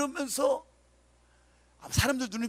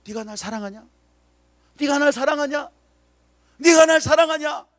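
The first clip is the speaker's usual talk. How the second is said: building plea; 4 semitones higher, 11 dB louder than usual. Acted. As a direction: let each ask grow louder and higher. Jesus asks Peter thrice.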